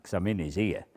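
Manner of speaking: ordinary talk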